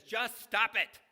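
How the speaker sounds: loud high barking yell; a mock shout of stop it; imitated yell. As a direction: yell it like the fed-up psychiatrist. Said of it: "he yells at them".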